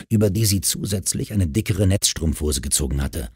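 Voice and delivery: ordinary delivery, nothing marked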